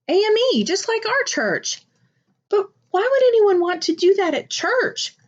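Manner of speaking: put-on high, young boy asking in disbelief; a puzzled little boy questioning his dad; child's voice. A narrator's reading. Young Jeremiah asking Dad why, in a high child's voice.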